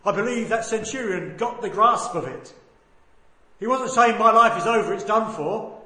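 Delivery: loud, ringing sermon delivery; full-force preaching. Real, not acted. Preacher proclaiming a cry of victory, preaching at full force. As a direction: proclaim it to the whole church.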